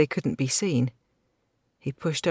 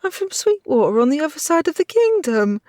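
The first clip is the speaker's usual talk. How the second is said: put-on sobbing girl; 12 semitones higher, 5 dB louder than usual. A narrator's reading. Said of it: "she sobbed".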